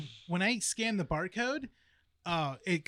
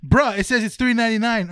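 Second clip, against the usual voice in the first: loud, raised-pitch imitation of a complaining customer; indignant kid; 6 semitones higher, 13 dB louder than usual. Store worker imitating a kid shown the wrong price, indignant complaint. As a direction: protest loudly like an affronted kid.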